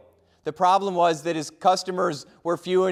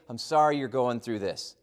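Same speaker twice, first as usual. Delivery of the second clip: gentle apology; 5 semitones lower, 4 dB quieter than usual. Shown as a demonstration. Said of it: "just say".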